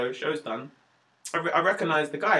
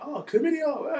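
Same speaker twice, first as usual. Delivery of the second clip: spring the pun on her like a gotcha; mock gasp of recognition; 12 semitones higher, slower than usual. Shown as a demonstration. Comic to an audience member, a high mocking gotcha. The punchline said to Clarissa.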